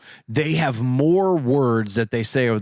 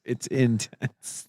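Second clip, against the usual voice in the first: slow emphatic; 5 dB quieter, much slower than usual. Shown as a demonstration. Repeating the pun slowly.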